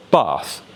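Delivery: "bath" with a long back "ah": "bahth". Shown as a demonstration. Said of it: "the R P version of this".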